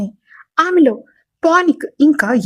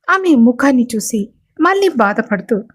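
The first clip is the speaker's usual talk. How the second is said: ordinary delivery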